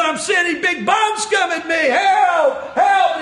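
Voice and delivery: high, frantic retold yelling; screaming for help. Drinker with the D Ts calling for help, acted screaming. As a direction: cry out in panic. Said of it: "He was screaming, he was crying out".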